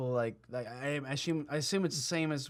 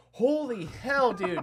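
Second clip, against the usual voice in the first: higher, drawn-out disbelief; acted astonishment; 5 semitones higher, much slower than usual. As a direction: stretch it out, stunned. Acted shocked reaction in story.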